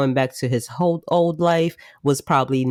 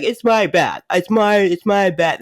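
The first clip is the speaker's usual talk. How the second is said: raised, insistent mock whine; Ming's whiny complaint; 6 semitones higher, 4 dB louder than usual. Ming protesting about his bath, mock whine. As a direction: whine like a territorial roommate. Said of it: "Ming is like".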